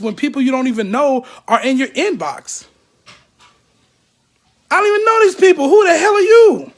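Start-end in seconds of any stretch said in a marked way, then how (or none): 4.7-6.7 much higher and louder, fed-up and incredulous; real exasperated outburst. Real, not acted. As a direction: blow up at the strangers messaging you. Vents at strangers in his inbox.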